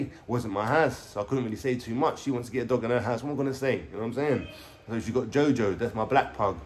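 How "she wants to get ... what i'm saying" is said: usual speaking voice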